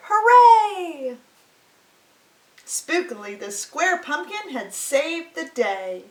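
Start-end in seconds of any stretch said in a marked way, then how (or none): 0.1-1.2 high, loud cheer of a crowd; cheering shout. Acted. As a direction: cheer out loud, hooray for the hero. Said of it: "All the pumpkins shouted".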